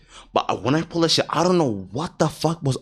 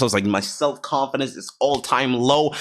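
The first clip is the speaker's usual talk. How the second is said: ordinary delivery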